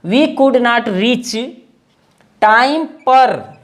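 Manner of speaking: each word drawn out slowly; slow dictation. Shown as a demonstration. Teacher dictating to the class, drawn out slowly. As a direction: dictate it so they can write.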